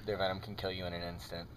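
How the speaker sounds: low, hushed wildlife host; hushed documentary narration. Pretend. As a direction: narrate like a nature show in hushed tones. Mock nature documentary host.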